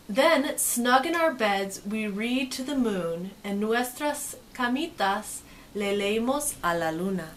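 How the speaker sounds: slow, cozy storybook voice; storybook read. Acted. Teacher reading the bedtime page to kids, slow and cozy. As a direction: ease them toward bedtime.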